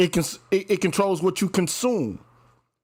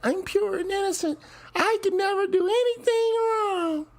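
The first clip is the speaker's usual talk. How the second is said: high mock innocent voice; squeaky pure-and-innocent act; innocent falsetto. Mocking innocent women's voice.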